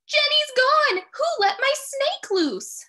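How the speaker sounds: high, loud panicked shout. Acted. Emily shouting to the class in alarm. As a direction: yell it out in alarm. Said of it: "Emily shouts".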